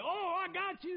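mock the guy who lands one punch; mock gloating. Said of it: "he's gonna be like".

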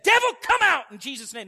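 loud, high command to the devil; commanding rebuke. Retold or imitated. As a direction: rebuke it with fierce authority. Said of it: "grabs a hold of that kid and said".

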